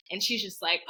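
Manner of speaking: ordinary talk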